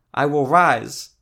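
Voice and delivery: loud, high, drawn-out cry; near shout. A narrator's reading. Sick woman declares it to the cleric, practically shouting. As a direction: belt it out slowly, almost shouting. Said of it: "She was practically shouting".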